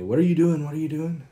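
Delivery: high, loud yelling at him; retold yell. Pregnant wife yelling at drunk husband in the car. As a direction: yell it from the driver's seat. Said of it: "she's yelling at me".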